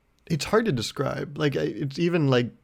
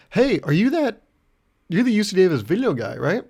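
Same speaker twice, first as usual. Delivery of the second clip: much higher, louder excited greeting; excited fan; 6 semitones higher, 5 dB louder than usual. Retold or imitated. A driver recognizing the video guy, excited. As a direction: call out like you just spotted someone famous.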